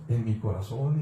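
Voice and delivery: ordinary talk, nothing marked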